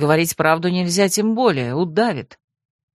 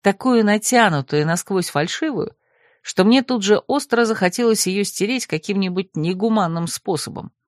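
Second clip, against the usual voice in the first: ordinary delivery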